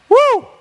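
loud, high "woo"; astonished whoop. Acted. Preacher voicing Jesus amazed at the centurion, astonished whoop.

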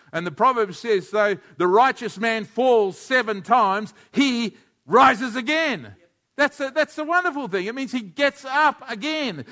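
high, loud and rousing; full-force preaching. Acted. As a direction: lift the room with a rising sermon.

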